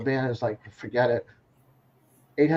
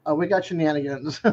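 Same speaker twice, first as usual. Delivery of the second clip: usual speaking voice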